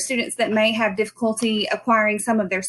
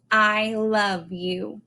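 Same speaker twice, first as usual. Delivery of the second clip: slow tender sentence; 3 semitones lower, much slower than usual. Shown as a demonstration. Teacher shares a favorite practice sentence, very slow and gentle. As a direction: linger on each word of "I love you". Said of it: "one of my favorite ones".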